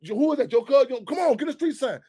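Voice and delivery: ordinary delivery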